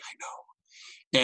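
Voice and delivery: whispered, quietly pleased with himself; smug whisper. Retold or imitated. Groom whispering back to his bride, smug and hushed.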